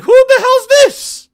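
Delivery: very high, loud, drawn-out mock squeal; shocked outcry. Viewer seeing her turn around, shocked squeal. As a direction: shriek it slowly in disbelief. Acting out his shocked reaction.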